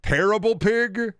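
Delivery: mock surprised. Mock reading name.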